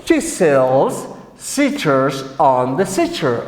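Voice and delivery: slow, low, evenly spaced words; tongue-twister model. Shown as a demonstration. Teacher having the class practise pronunciation, slow deliberate model. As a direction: pronounce it slowly for learners to echo. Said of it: "Repeat again".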